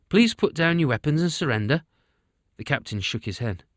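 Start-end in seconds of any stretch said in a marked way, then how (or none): 0.1-1.8 firm demand. A narrator's reading. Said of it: "Tommy spoke again".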